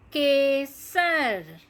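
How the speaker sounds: Kesar drawn out, ke-sar, clear and even; slow model reading. Shown as a demonstration. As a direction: model Kesar slowly for children. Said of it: "धीरे धीरे सही उच्चारण".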